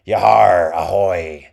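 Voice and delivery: loud gleeful pirate holler; pirate skeleton. A narrator's reading. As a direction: bellow it from the rooftop. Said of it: "The skeleton cackled with glee".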